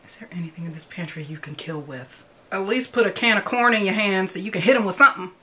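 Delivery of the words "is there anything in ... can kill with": urgent hushed whisper. Real, not acted. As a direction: whisper so nobody hears. Character hiding in pantry.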